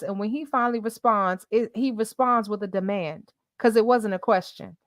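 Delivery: ordinary delivery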